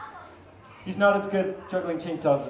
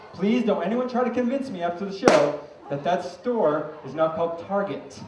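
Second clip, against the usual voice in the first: ordinary talk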